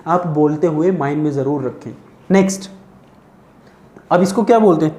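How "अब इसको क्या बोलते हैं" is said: usual speaking voice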